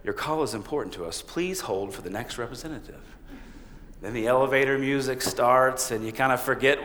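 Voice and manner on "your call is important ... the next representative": quick, flat recorded hold message; like a canned phone system; automated phone voice. Retold or imitated. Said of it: "Then an automated voice says".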